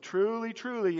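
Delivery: "truly, truly" lifted high, both words weighted; attention-calling emphasis. Retold or imitated. Preacher quoting Jesus opening an important statement, emphatic. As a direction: say "truly, truly" as a high call to listen up. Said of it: "it's like, "Listen up, folks".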